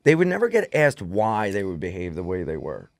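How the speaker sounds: ordinary talk, nothing marked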